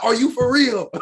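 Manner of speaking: high, loud incredulous question; excited reenacted disbelief. Retelling an outburst alone in the car, loud disbelief. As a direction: shout the question, voice up. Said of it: "I was like".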